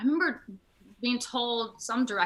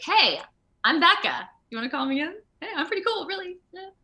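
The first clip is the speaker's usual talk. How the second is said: higher, upbeat sell-yourself voice; cheery self-pitch; 9 semitones higher, 3 dB louder than usual. Retold or imitated. Pitching herself to casting directors, a higher eager voice. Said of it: "Be like".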